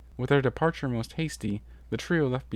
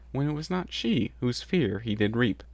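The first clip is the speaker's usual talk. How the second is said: ordinary delivery, nothing marked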